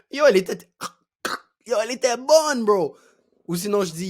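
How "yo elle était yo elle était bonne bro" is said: higher, louder, punchy bursts; hyped retelling. Shown as a demonstration. Teacher retells an apple story with gestures, hyped. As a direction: gush about it to your bros.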